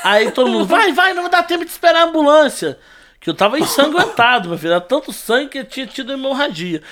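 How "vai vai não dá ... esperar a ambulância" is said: high-pitched crowd's voice; retold urgent urging. Crowd rushing the victim into the van, urgent high call. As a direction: hurry them along, mock urgent.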